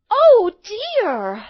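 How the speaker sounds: put-on high, loud girlish dismay; dismayed little girl. A narrator's reading. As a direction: worry aloud as a little girl. Girl worried by hoarse granny.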